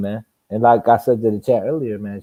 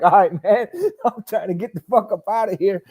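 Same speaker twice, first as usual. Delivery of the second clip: higher, laughing through it; laughing plea; 7 semitones higher than usual. Retold or imitated. Tired boxer quoting his plea to quit, laughing. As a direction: beg to stop, amused.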